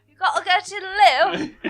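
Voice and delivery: put-on Cockney accent. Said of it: "I cannot do anything but".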